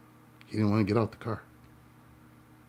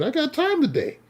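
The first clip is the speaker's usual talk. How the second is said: much higher, sing-song I got time today; gleeful taunt; 3 dB louder than usual. Retold or imitated. To the road-rage driver, a sing-song taunting dare. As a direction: dare him with a grin. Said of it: "So I told him".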